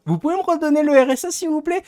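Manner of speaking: high, fast, put-on begging voice to the job centre; mock pleading. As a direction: beg sweetly in a squeaky voice. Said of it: "je vais faire".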